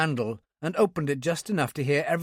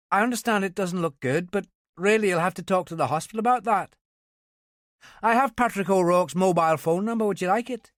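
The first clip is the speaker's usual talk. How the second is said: higher, brisk Belfast sergeant on the phone; young Ulster policewoman; 6 semitones higher than usual. A narrator's reading. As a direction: voice a young Northern Irish policewoman.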